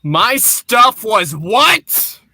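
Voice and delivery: shocked outburst over lost luggage; outraged disbelief. Acted. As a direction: stretch the last word in disbelief. Hero hears luggage destroyed.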